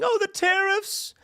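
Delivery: high, slow, whiny mock wail; mock libertarian whining about tariffs, high and slow; mock crying libertarians. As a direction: whine it like a spoiled libertarian losing cheap cars. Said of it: "They're crying".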